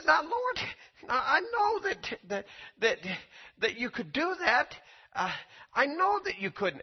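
high plea from Kenneth Hagin to the Lord; pleading question. Retold or imitated.

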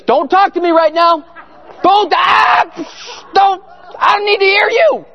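high, loud, near-crying shout; panicked refusal. Retold or imitated. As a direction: yell to shut out the voice, cracking toward tears. Panicked refusal to hear Jesus.

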